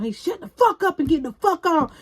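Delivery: high, loud furious yell; retold angry yell. Boyfriend yelling at honking drivers, furious and loud. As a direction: yell it out the window at them.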